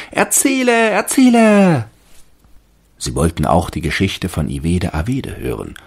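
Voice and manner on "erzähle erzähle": hohe, laute Kinderstimme, gedehnt; rufende Kinder. A narrator's reading. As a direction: wie bettelnde Kinder rufen. (hoch und laut wie Kinder rufen)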